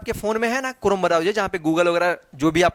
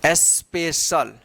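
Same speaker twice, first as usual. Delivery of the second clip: Special slow and lower, split into its syllables; 5 semitones lower, much slower than usual. Shown as a demonstration. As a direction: sound out special part by part. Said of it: "क्या पढ़ेंगे".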